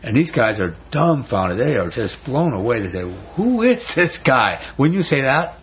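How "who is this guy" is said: high, slow amazed question; awed question. Retold or imitated. Disciples stunned after the storm, awed slow question. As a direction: ask it stunned by the miracle.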